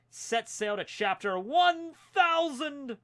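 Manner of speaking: slow, high rallying call; dramatic cheer. Acted. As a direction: proclaim it like a pirate battle cry. Reading fan letter's sign-off cheer.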